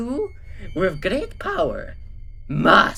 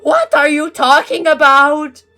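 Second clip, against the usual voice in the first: much higher, loud, slow; baffled shout; 9 semitones higher, 10 dB louder than usual. Acted. Gru yelling at Mysterio. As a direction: shout in confusion. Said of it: "asked Gru".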